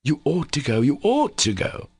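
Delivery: "ought" pitched high and stretched, repeated insistently; merciless emphasis on OUGHT. A narrator's reading. Alyosha presses Katya to go, stressing "ought" mercilessly. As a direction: press hard on "ought" each time. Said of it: "repeated with merciless emphasis".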